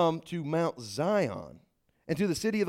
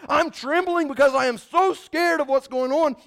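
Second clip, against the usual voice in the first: higher, louder, frightened voice; scared trembler; 9 semitones higher, 7 dB louder than usual. Acted. Preacher contrasts scared people at Sinai, voiced high and loud. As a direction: play someone shaken with fear. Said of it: "Listen to the contrast here".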